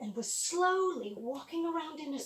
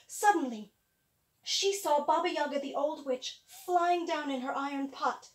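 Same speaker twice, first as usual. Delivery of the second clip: plain reading voice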